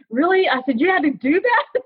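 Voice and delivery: high-pitched incredulous reaction; mock indignation. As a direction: question it in mock outrage. Retold indignant reply to kid.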